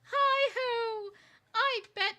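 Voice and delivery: high, loud, put-on stranger's voice; odd stranger voice. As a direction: play a strange coffee-shop oracle. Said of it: "somebody goes".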